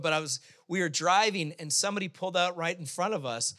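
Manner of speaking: ordinary talk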